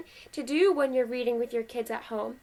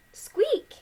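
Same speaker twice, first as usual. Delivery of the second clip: very high mouse voice; a single high-pitched squeak; storybook mouse squeak; 10 semitones higher than usual. Acted. Reader answers what the mouse said, high squeak.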